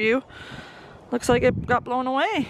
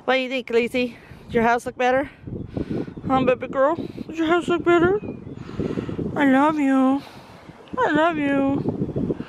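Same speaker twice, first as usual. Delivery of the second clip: cooing; 4 semitones higher than usual. Real, not acted. Owner showing the dog its fixed house, loving croon.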